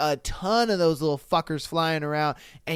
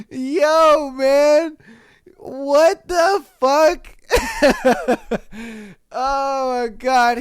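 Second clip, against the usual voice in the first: much higher, louder, slow wheezing laugh; crying with laughter; 7 semitones higher, 4 dB louder, much slower than usual. Real, not acted. Reacting to the poop-killer post, crying with laughter. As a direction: lose it completely, laughing to tears.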